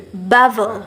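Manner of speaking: "bubble" stressed on its first syllable. Shown as a demonstration. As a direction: hit the start of "bubble", let the rest fall away. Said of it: "penekanan yang terjadi di suku kata yang pertama".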